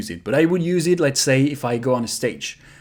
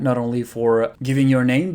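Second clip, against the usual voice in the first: usual speaking voice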